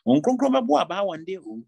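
booming pulpit warning. Acted. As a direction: thunder the warning at the church. Preacher's warning, then quiet echo.

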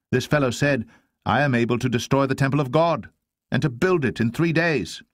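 high-pitched testimony against the accused; accusing witness. Acted. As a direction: report it as damning evidence, voice lifted. False witnesses accuse Jesus.

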